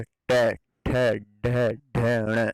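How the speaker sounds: "ट ठ ढ ण" each sounded separately; tongue curled back for "ट ठ ढ ण"; retroflex sounds demo. Shown as a demonstration. Teacher to students, sounding out "ट, ठ, ढ, ण" one by one. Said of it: "उच्चारित करके देखिए".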